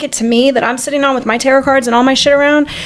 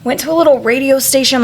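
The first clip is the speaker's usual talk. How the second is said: ordinary talk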